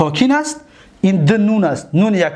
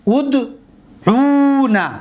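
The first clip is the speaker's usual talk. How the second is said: very slow ادعونا, higher and sung out; exaggerated slow model; 5 semitones higher, much slower than usual. Shown as a demonstration. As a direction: stretch ادعونا long and high.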